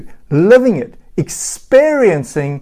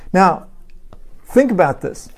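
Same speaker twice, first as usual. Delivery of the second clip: ordinary talk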